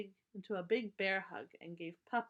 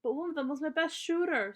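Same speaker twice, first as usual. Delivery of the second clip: put-on higher and louder child's protest; upset protest; 8 semitones higher, 6 dB louder than usual. Brother protests to Mama about his marble, higher and louder. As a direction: plead in alarm.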